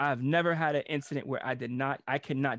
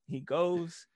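usual speaking voice

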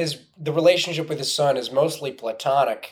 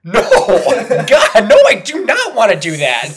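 loud, laughing no way; horrified laughing shout; 8 semitones higher, 10 dB louder than usual. Real, not acted. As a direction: shout it out, cracking up.

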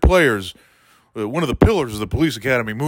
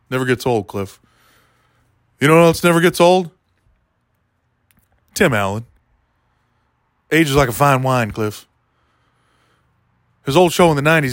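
ordinary talk, nothing marked

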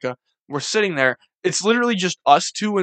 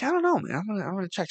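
mock unsure; 4 semitones higher, 8 dB quieter, faster than usual. Unsure answer to friend's weed question.

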